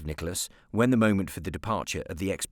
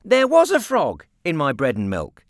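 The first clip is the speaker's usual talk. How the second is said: put-on firm, louder boyish repeat; insistent repeat; 7 semitones higher, 8 dB louder than usual. A narrator's reading. Boy repeating to aunt, firmly insistent. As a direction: dig in and repeat it. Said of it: "he repeated, with the insistence".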